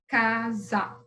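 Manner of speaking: "casa" split into ca, za; syllable by syllable. Shown as a demonstration. Teacher asking how often the mouth opens, "casa" in syllables. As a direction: say "casa" in two separate beats. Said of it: "Quantas vezes você abre a boca pra falar".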